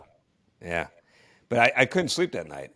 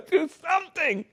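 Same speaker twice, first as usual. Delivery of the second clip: higher, louder, mock-desperate orders through laughter; hammed-up desperate demand; 12 semitones higher, 5 dB louder than usual. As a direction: plead for the impossible, half laughing. Absurd demand to turn the station.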